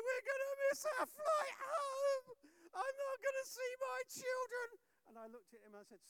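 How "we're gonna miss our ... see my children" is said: high, near-tearful panicking friend; panicked wail. Retold or imitated. Pastor imitating his panicking friend Slava, high tearful wail. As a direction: wail it like he's lost all hope.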